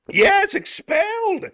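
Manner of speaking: shouted outrage, drawn out; outraged shout. Acted. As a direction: bellow the word at him. Headmaster erupts at father.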